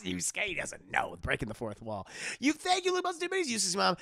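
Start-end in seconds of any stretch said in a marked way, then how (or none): 2.4-3.5 much higher playful voice; high squeaky. Retold or imitated. Character squeaky line.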